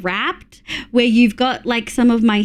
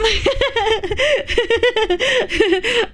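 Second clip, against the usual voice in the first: high nervous giggle; 9 semitones higher than usual. Real, not acted. Streamer giggling while trying to announce her presentation. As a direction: laugh while trying to continue.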